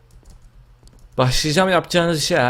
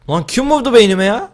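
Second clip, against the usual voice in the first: indignant shout; 9 semitones higher, 6 dB louder than usual. Real, not acted. Shot in the head.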